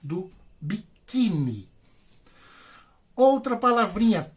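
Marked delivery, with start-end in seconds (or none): 0.6-1.7 biquíni said slowly with QUÍ drawn out; slow teacher's biquíni, softer, middle syllable stressed. Shown as a demonstration. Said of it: "aqui tá o acento".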